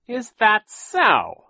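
very slow, high, loud sneer; mocking villain. A narrator's reading. Captain Sham mocking Klaus, slow and high. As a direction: drag it out in mock doubt.

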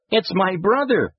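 put-on high, urgent child voice; pleading youngster. A narrator's reading. Boy explaining to the angry driver, pleading. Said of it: "pleaded the youngster".

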